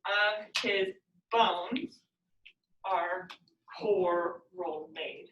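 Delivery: of his BONES, stretched out with pauses; slow metrical scansion. Shown as a demonstration. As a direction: beat out bones and coral made very slowly. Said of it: "you see how it always kind of will flip back".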